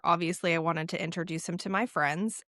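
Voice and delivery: ordinary talk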